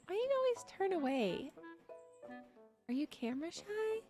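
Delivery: ordinary delivery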